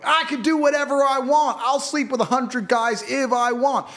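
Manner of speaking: high, loud sneering mimicry of a brash woman; mock her in a high put-on voice; mocking idiot voice. Mocking the vocal minority in a high sneer. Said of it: "these five percent of idiots you see going".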